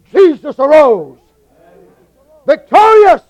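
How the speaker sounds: building to high shouted "Done" and "Victorious"; preaching at full force. Acted. Preacher to congregation, shouting the victory. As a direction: lift the roof on the resurrection.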